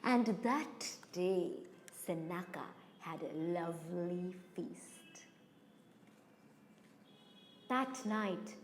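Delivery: low, hushed narration with long pauses; ominous storytelling. A narrator's reading. Storyteller revealing the hidden snake, low ominous hush. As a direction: drop low and soft, drawing out the menace.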